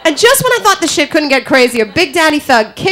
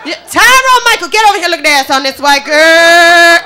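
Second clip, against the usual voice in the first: loud call-out; 5 semitones higher, 3 dB louder than usual. Acted. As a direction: holler it across, stretching "girl". Loud acted call-out opening the bit.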